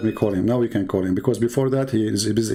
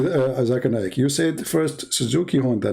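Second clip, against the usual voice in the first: ordinary talk, nothing marked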